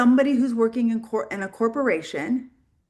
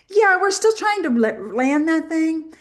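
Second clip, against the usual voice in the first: imitating the friend; 7 semitones higher, 3 dB louder than usual. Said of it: "And she goes".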